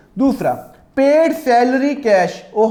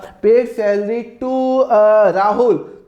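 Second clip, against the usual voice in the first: very slow, drawn out like reading from the book; slow reading of the question; much slower than usual. Shown as a demonstration. Teacher posing a hypothetical salary question, reading it slowly. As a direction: read the sample question out word by word. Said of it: "एक बात बताओ".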